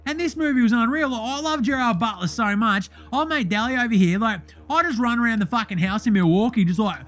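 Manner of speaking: brash bogan voice throughout; Deli character voice. Acted. Host plays the character Deli.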